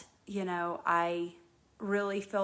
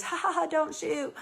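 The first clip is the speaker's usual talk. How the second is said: high, mock laugh running into the words; imitated laughing; 9 semitones higher than usual. Host mimics Jermaine joking as he walked in, laughing. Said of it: "he was laughing when he said it".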